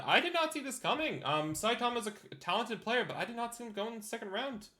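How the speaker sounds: high-pitched astonished reaction; surprised. Real, not acted. Commentator reacts to staff member picked in second round, surprised. Reacting to an unexpected draft pick.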